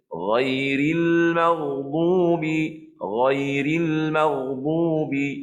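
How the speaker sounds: very slow chanted "Ghairil maghdubi", gh unrounded; ghain yang betul. Shown as a demonstration. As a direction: recite "Ghairil maghdubi" long-drawn, tongue root only. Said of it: "Ha, nampak?". (bacaan "Ghairil maghdubi" berlagu dan perlahan)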